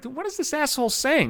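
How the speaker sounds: baffled. Retold or imitated. Baffled at neighbor's yelling.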